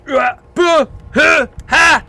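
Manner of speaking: high, forceful exclamation bursts; loud shouted "ha". Pretend. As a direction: punch out loud, high yells. Loud shouted noises before sponsor plug.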